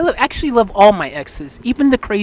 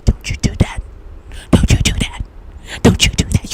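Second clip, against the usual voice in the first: warning whisper. Retold or imitated. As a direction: hiss the warning like your gut talking. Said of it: "the tingling sensation telling you".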